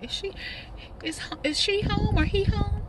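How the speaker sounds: high, slow polite caller voice; mock visitor at the door. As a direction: sweetly ask for her at the door. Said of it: "Talking about".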